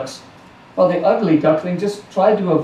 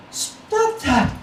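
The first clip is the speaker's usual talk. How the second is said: mother duck cries out; 10 semitones higher, 3 dB quieter than usual. A narrator's reading. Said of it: "cried the mother duck".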